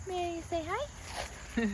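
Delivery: high, slow coo to a pet; talking to a dog. Real, not acted. Talking sweetly to her dog.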